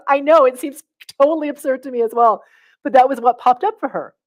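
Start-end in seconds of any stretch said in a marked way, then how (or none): 0.1-2.4 higher and louder, lightly voiced friend admitting it; hint of the friend, amused at herself; friend agreeing. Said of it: "She goes".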